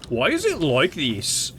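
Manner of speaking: slow, swooping baffled whine; mock clueless critic. Imitating incoherent critics, slow mock-baffled whine. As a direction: drag out the question in mock confusion. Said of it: "Like, just asking".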